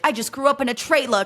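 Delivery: a hint of a Boston accent; mock Boston accent. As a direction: lightly mimic a Boston bad girl. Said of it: "like, Boston, bad bitch".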